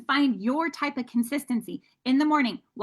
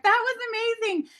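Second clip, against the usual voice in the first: much higher, excited praise; friends cheering; 11 semitones higher than usual. Retold or imitated. Friends laughing at her first live, gushing much higher. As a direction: gush over it like an amused fan. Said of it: "People laughing at me like".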